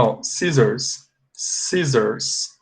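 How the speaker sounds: scissors said slowly, double s voiced as z; slow model word. Shown as a demonstration. Teacher modelling scissors for the class. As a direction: stretch out scissors with a buzzing z. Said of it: "This is the way you pronounce this word".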